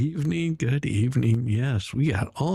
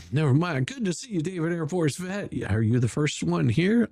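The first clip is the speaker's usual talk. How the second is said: ordinary talk, nothing marked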